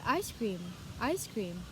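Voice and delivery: "ice cream" run together with no pause; two words linked without a break; closed juncture. Shown as a demonstration. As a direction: say "ice cream" as one joined word. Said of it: "there's no pause".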